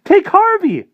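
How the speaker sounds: high, loud wailing sob; sobbing plea. Acted. Weeping boy begs the vampire to spare him, sobbing. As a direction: cry and beg at once. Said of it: "he sobbed".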